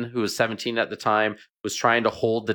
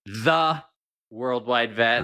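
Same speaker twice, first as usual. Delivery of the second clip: "The" said as "thuh", set apart by a pause; correcting with a weighty, separate "The"; stress on THE; slower than usual. Real, not acted. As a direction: hit "The" alone, pause, then "Worldwide Vet". Guest corrects "a" to "the.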